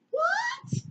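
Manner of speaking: extremely high, squeaked disbelief; comic falsetto double take; mock startled question. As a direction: squeal it as a baffled crowd would. Everyone reacts to the scream.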